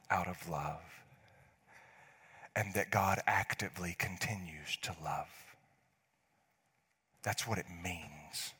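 slow, whispered reassurance; tender whisper. Real, not acted. Pastor telling listeners God dreamt them up, a tender whisper. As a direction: whisper it gently, like good news.

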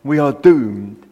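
slow, mild gloomy quote; a light touch of Fraser's gloom; doomed catchphrase. Retold or imitated. Preacher citing Fraser's line, mildly gloomy. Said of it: "Private Fraser's exclamation".

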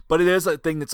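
ordinary delivery

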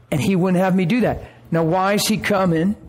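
usual speaking voice

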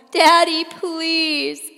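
begging Daddy as she's hauled outside; crying out. A narrator's reading. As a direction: cry out to your father one last time. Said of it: "I cry".

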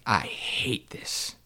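whispered, slow and drained; despairing whisper. Retold or imitated. Walking off stage after butchering the organ piece, whispered despair. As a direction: breathe it out like you've just failed. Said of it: "I was like".

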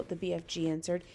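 plain reading voice

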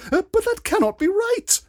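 very high, loud falsetto lady; startled protest. A narrator's reading. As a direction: squeal your shocked disbelief. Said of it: "exclaimed a startled Lady Peewit".

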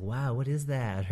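gawping newcomer, playfully mimicked; wowed wedding guests. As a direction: lightly imitate a wide-eyed guest. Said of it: "they're always like".

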